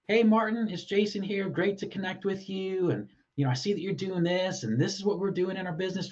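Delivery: bright, friendly message read with a high pitch; really friendly. Shown as a demonstration. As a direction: read it as a cheerful friendly hello. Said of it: "You may write it in that tone of voice, really friendly in nature".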